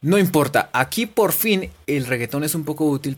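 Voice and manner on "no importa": ordinary talk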